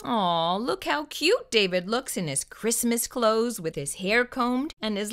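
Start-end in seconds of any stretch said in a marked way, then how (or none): none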